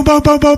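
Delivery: high loud booms imitating shots; gunshot booms. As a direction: fire off each boom.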